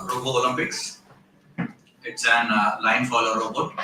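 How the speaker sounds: ordinary talk, nothing marked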